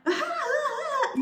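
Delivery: imitating friends fawning over her with a big high laugh; over-the-top adoring giggle; delighted laugh. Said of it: "But when they're around her".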